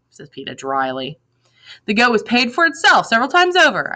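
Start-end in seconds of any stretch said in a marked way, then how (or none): 1.8-3.9 high, smug and self-satisfied; superior tone. A narrator's reading. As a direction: lord it over him with pride. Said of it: "I say in a superior tone".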